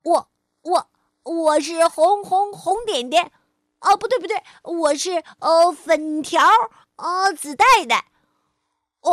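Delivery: loud, flustered stammer; nervous stuttering chameleon. Acted. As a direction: stumble over the words in fright. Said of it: "变色龙结结巴巴地说".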